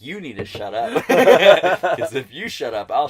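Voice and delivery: loud laughing scold; scolding her sister and herself, laughing. Real, not acted. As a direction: laugh through a mock telling-off.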